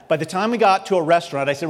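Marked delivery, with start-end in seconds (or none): none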